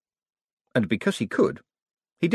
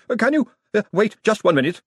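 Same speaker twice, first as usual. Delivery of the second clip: high, loud, hesitant interruption; alarmed stalling, pitched well up; flustered wizard; 12 semitones higher, 4 dB louder than usual. A narrator's reading. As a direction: stammer out a plea to hold on. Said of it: "raised his hands quickly as if to ward off".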